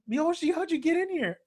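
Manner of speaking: high, startled demand. Retold or imitated. Washu finds Mihoshi in the room, startled. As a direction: imitate someone thrown by an intruder. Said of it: "just startled and saying".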